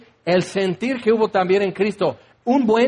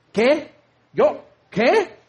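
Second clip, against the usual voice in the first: higher, startled short questions; mock shocked; 6 semitones higher, much slower than usual. Servant Agar told to sleep with master, mock shocked. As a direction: jump up in disbelief.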